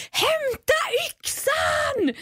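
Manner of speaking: high, slow shout for the axe; panicked shout. Retold or imitated. Retelling finding the crab, she shouts high and slow in panic. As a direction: yell it in panic. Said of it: "Och jag bara".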